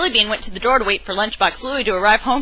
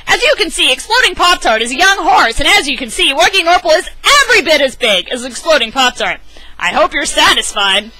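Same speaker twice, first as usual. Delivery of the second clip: higher, louder eager dad voice; an excited owner bragging about his growing cat; bouncy, proud storybook dad; excited Lunchbox Louie; 6 semitones higher, 5 dB louder than usual. Acted.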